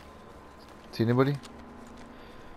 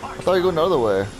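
angry yelling; 8 semitones higher than usual. Real, not acted. Teammate blocked his throwable shot.

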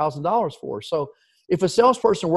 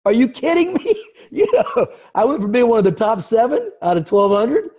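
high, loud, laughing disbelief; incredulous; 7 semitones higher, 5 dB louder than usual. Real, not acted. Manager recalling being fired, laughing disbelief. Incredulous at losing his job.

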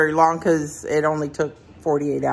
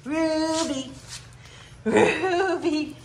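calling the dog; 8 semitones higher, 3 dB quieter, much slower than usual. Real, not acted.

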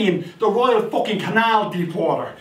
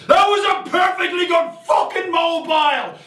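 higher, shouted outrage; yell; 8 semitones higher than usual. Retold or imitated. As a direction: bellow it from the water like a god. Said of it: "and I yell".

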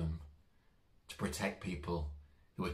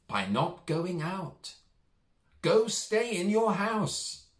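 firm nurse; 11 semitones higher, 6 dB louder, much slower than usual. Acted. Nurse tells the mouse.

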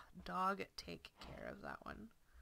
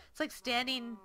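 ordinary delivery

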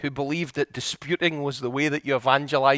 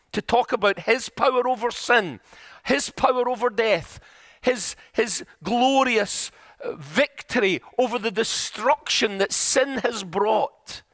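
high, ringing delivery with weighty phrasing; impassioned preaching; 6 semitones higher than usual. Real, not acted. Preacher proclaims power over sin and death, in impassioned preaching voice. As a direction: proclaim each phrase like a climax.

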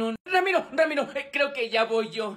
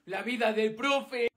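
normal talking voice

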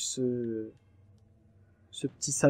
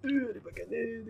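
alarmed squeak; 10 semitones higher, 6 dB quieter than usual. Real, not acted. Spider still alive.

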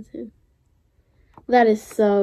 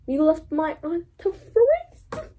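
high, slow mock accusation; mock dramatic; 7 semitones higher, 4 dB quieter, slower than usual. Fan scolding evil Hans, mock dramatic. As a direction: draw it out in hammed-up distress. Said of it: "I'm so dramatic".

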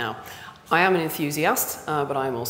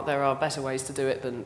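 normal talking voice

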